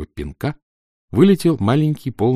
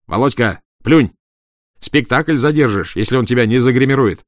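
loud urging; 4 semitones higher, 6 dB louder than usual. A narrator's reading. Stage manager urging the actor.

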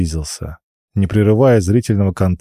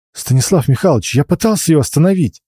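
flustered duty officer; 7 semitones higher than usual. Acted. Breathless duty officer protests.

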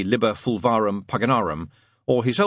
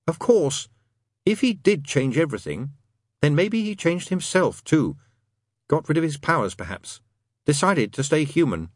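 lightly voiced musing demon, higher pitch; Crowley; 6 semitones higher than usual. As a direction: speculate aloud, phrase by phrase.

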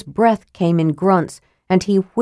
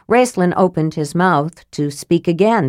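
usual speaking voice

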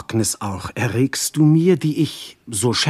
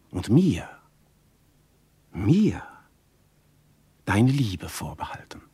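intimate whisper. Acted. Lover claims Alkmene's love for himself, whispering. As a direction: breathe it close to her ear.

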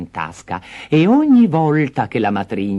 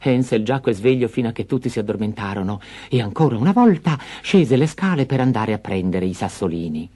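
usual speaking voice

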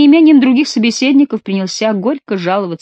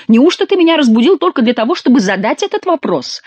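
indignant exclaim; 7 semitones higher, 4 dB louder than usual. A narrator's reading. Chicot woken in the night, indignant.

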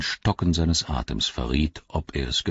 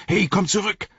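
shouted. A narrator's reading. Sam shouting after Gollum, much higher. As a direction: yell after him to come back. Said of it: "schrie Sam".

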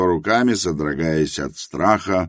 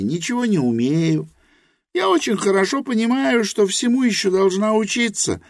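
Helena's voice; 11 semitones higher than usual. A narrator's reading. Helena admits her ignorance to Rosa, high and earnest. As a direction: confess humbly in Helena's high voice.

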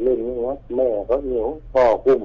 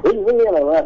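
urgent reassurance; 10 semitones higher, 3 dB louder than usual. Retold or imitated. Uncle hauling him out.

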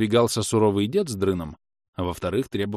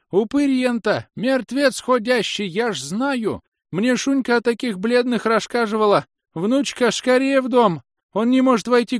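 put-on much higher, loud panicked old woman's screech; shrieking old woman; 5 dB louder, slower than usual. A narrator's reading. Scene: grandmother shrieks at the pale stranger, terrified. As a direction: screech in terror at the vampire. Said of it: "Пронзительно заверещала бабка".

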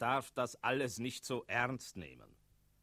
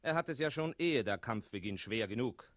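normal talking voice

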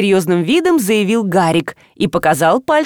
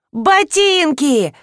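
sudden realization; 5 semitones higher, much slower than usual. A narrator's reading. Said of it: "осенило Сеню".

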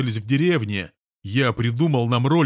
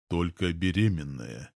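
softer, lower, puzzled murmur; said aloud quietly; 5 semitones lower, 6 dB quieter than usual. Real, not acted. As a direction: trail off wondering aloud. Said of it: "сказал вслух". (тихо вслух, растерянно)

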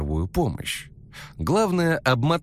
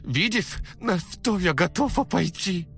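gurgling through blood, high; 11 semitones higher than usual. Acted. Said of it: "пробулькала она".

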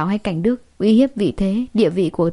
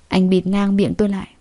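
usual speaking voice

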